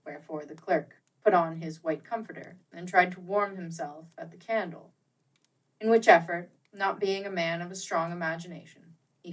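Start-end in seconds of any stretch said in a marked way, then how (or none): none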